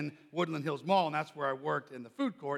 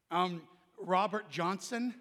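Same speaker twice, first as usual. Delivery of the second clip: slow, unsure answer; stall, then offer the name tentatively; acted-out sheepish bluff; hesitant fib; slower than usual. Gives manager a hesitant false name.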